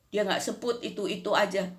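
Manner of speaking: ordinary talk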